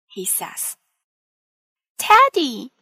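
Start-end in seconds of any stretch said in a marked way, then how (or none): 2.0-2.7 high, loud joyful cry of the name; Teddy! called out in delight, pitched high. A narrator's reading. Peppa greeting her returned bear with a high, loud happy cry. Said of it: "cries Peppa".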